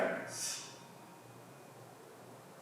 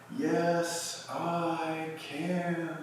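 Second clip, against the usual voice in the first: much higher, drawn-out echo; distant inner echo; 9 semitones higher, much slower than usual. Acted. Yoga teacher voices the answer from the mind's recesses, echoing. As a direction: call it like an echo from far back in the mind. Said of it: "almost like an echo from way back there".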